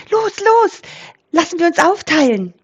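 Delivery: very high, hurried goose voice; flapping, eager high squawk; excited wild goose. A narrator's reading. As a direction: urge everyone on, high and fast. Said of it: "ganz aufgeregt".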